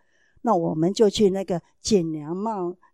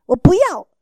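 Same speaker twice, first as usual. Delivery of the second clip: put-on defiant child yell; 7 semitones higher, 12 dB louder than usual.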